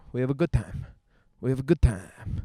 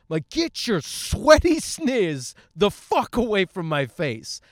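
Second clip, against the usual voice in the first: high, loud mock outrage; indignant rant; 5 semitones higher, 4 dB louder than usual. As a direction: let the exasperation fly, punching the words. Acted outrage at gym intruder.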